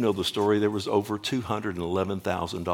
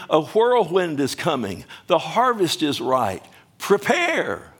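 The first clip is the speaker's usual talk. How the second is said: full-force preaching; 7 semitones higher than usual. Acted. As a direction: preach it out with fire.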